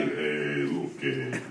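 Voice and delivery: low, hesitant Israeli voice with many eh's; Israeli accent. Retold or imitated. Mocking a tattooed audience member as an Israeli, low accent.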